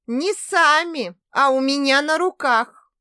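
high, loud, slow retort; lively correction. Acted. Daughter correcting her mother cheerfully, lively retort.

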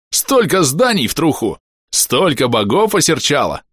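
high, exuberant boss-praising gush; elated praise. A narrator's reading. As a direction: crow over a glorious success. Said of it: "ко мне подскочил сияющий бом".

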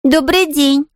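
put-on high, courteous girl's voice; polite little girl. A narrator's reading. Girl greeting the maid at the gate, polite. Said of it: "вежливо начала Полианна".

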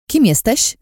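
high, loud, sharp question; snarled challenge. A narrator's reading. Friend challenging an unrecognised intruder, snarled. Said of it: "usłyszałam warknięcie".

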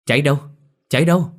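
high, loud, panicked questions; frantic. A narrator's reading. Lâm runs out with water asking where the fire is, loud and frantic. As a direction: call out in a frantic rush. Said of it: "hớt hải".

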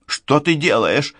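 high-pitched, worried cry; anxious exclamation. A narrator's reading. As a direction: exclaim in alarm, pitch jumping up. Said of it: "тревожно восклицает Денис".

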